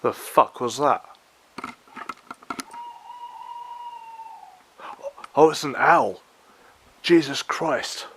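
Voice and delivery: spooked by a sudden noise; startled fright. Real, not acted. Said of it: "that freaked me out for a second".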